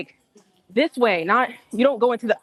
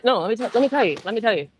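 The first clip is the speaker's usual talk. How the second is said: normal talking voice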